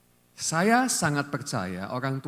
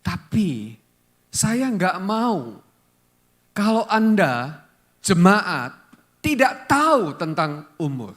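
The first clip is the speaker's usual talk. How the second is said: urgent preaching; 5 semitones higher, 6 dB louder, slower than usual. Real, not acted. Preacher pressing the congregation.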